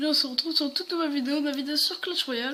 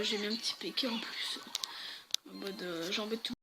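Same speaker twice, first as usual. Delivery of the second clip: whisper; 4 semitones lower, 10 dB quieter than usual. Real, not acted. Aparté chuchoté sur le deck.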